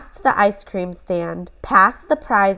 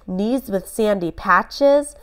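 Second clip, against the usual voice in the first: plain reading voice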